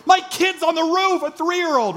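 high, loud frantic mother's cry; panicked mom. Retold or imitated. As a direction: play the mom panicking at her kid on the roof. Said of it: "She's freaking out as well".

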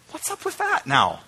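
high, soft comic squeak; mock puzzlement. Preacher questioning the backwards lesson, squeaky mock puzzlement. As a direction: pitch it way up in mock bafflement.

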